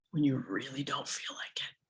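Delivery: hushed emphasis. Real, not acted. Coach challenges listeners to show up, hushed. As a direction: drop to a near whisper.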